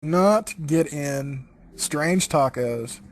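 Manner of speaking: ordinary talk, nothing marked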